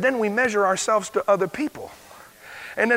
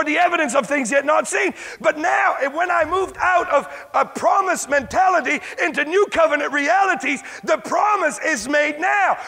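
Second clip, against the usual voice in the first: higher, shouted sermon cadence; full-force preaching; 7 semitones higher than usual. Real, not acted. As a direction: preach it with fire.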